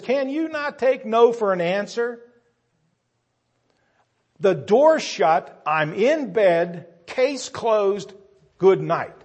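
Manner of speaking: loud, slow, final and harsh; stern cold refusal. Acted. Man upstairs to the persistent friend, loud stern refusal. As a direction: slam the case shut with each word.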